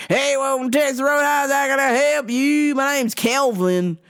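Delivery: high, drawling waiter impression; put-on high server voice; waiter greeting. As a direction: imitate a server's rehearsed welcome. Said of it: "he comes up, he's like".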